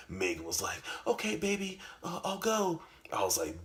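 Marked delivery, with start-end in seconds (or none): 1.1-2.8 put-on much higher, sweet girlfriend voice. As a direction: answer him like a sweet girlfriend.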